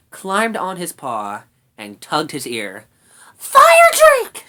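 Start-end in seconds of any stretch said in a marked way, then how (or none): 3.4-4.4 very high, loud shout of a name; urgent squeaky rat shout. A narrator's reading. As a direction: yell to wake a sleeper. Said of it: "she shouted".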